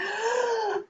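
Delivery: high, drawn-out trumpeting noise; elephant trumpet. Acted. Elephant blows after sucking in air.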